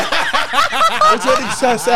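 laughing mock outrage. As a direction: stretch it out, cracking up. Joking offence at fat remark.

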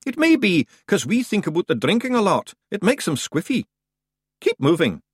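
high-pitched Scottish clansman, matter-of-fact; cocky Scottish Feegle. Acted. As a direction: play a high-voiced Scottish scrapper.

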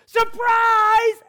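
high, loud party yell; long, high-pitched holler; surprise shout. Acted. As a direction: shout it like a whole crowd. Party crowd yells surprise.

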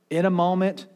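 ordinary delivery

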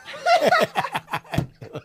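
high burst of laughter. Real, not acted. Host laughing at his own rant about Columbus's ships, high laugh. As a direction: break into a giggle at your own rant.

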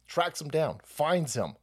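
ordinary talk

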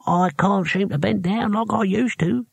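put-on lisping old hero, sheepish. A narrator's reading. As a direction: admit it through bare gums. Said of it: "said Cohen sheepishly".

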